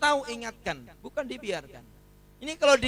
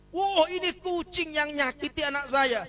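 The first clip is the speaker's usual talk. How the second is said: enraged mother; 8 semitones higher, 4 dB louder than usual. Acted. Mother sees the bloody cat.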